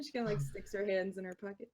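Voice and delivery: normal talking voice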